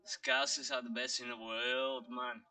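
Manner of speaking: put-on Scouser pride; Scouse accent. Shown as a demonstration. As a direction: brag like a Liverpool lad. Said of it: "he says you can do British accent".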